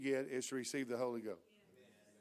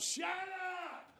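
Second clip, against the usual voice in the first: put-on much higher, a child-like shout of shut up; Mitzi's shouted outburst; 14 semitones higher, 4 dB quieter than usual. Preacher imitating Mitzi interrupting the prayer, high mock shout. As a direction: mimic a woman-child blurting across the church. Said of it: "Misty said out real loud".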